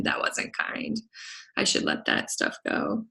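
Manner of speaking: a sheepish whispered aside to himself. Real, not acted. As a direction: drop to a whisper as if confessing. Self-reproach after mocking a client.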